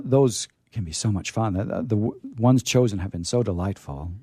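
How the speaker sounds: ordinary talk, nothing marked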